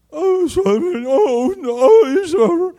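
high, mock crying lament; hammed-up self-pitying wail. Mock wailing self-pity in prayer.

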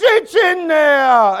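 long, slow, wailing shout; Italian papa bellowing. Retold or imitated. Papa cutting off son's question, hammed-up wailing bellow. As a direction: bellow it with stretched-out words. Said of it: "he says".

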